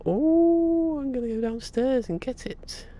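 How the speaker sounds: high-pitched delighted squeal; a long, loud high "oh", then rush on in a high voice; excited. Real, not acted. Parcel is out for delivery.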